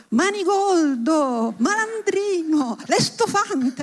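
theatrical mock outrage, each word stretched; indignant shout. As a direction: hurl old-fashioned insults from the balcony, slowly. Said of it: "gridò".

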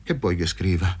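halting, repeated first word; crushed stammer. A narrator's reading. Paroni forced to write at gunpoint, crushed stammer. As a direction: stammer it out, overwhelmed. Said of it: "balbettò Paroni annichilito".

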